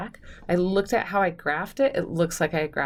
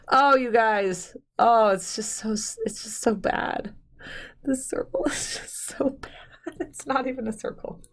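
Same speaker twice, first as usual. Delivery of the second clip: laughing; 5 semitones higher than usual. Real, not acted. Laughing at her bad circle.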